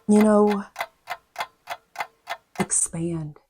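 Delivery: ordinary talk, nothing marked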